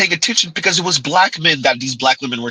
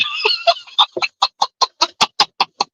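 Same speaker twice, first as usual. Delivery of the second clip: shrill laugh way up high; high-pitched cackle; high squealing laugh. Real, not acted. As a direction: burst into a high helpless laugh. Mocking laughter fit.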